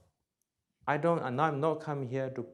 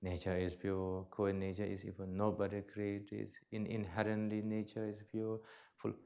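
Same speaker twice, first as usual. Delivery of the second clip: soft mantra; 4 semitones lower, 8 dB quieter than usual. Shown as a demonstration. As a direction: murmur it gently like a chant. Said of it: "Then you can repeat".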